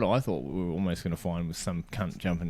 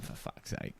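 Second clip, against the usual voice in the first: whispered under the breath, much softer; exasperated whisper; 14 dB quieter than usual. Retold or imitated. Retelling his fed-up aside about the clingy stranger, whispered. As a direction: mutter it so the stranger can't hear.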